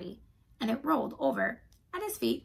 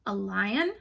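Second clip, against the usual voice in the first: ordinary delivery, nothing marked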